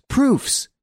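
high, breathless burst of Proofs; stammered exclamation. A narrator's reading. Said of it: "stammered Hortense".

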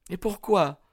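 put-on high girlish question; Etty, questioning. A narrator's reading. Young woman questions her suitor's plan, high uneasy voice.

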